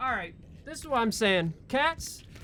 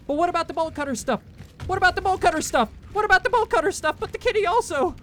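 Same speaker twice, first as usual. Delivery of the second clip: fast, rising higher each time; frantic repetition; 9 semitones higher, faster than usual. Real, not acted. Fleeing gamer frantically repeats the question, each time higher and faster. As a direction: blurt it faster and higher in a panic.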